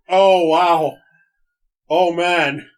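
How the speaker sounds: loud, amazed exclamations; excited exclaiming. Real, not acted. Overwhelmed exclamations.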